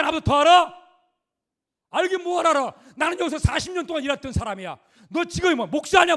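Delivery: put-on indignant yell of a proud old fisherman; furious shout. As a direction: bellow it at him like an insulted fisherman. Pastor acts Peter shouting at Jesus.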